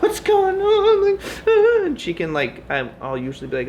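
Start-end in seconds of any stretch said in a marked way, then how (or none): 0.0-1.2 very high, loud, slow squeaky panic; mock the wife's panicked squeak; imitating wife. Vlogger imitates his wife panicking, squeaky and very high. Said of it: "Kristen's always like". 1.5-1.8 very high, loud squeal; mock panicked squeal. Vlogger imitates his wife's high panicked squeal. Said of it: "Kristen's always like".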